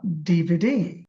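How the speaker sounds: slow DVD with the last ডি held and rising; stress on last letter. Shown as a demonstration. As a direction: lean on the final ডি in DVD. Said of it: "সবগুলো একই অবস্থা".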